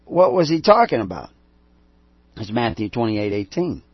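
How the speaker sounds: ordinary delivery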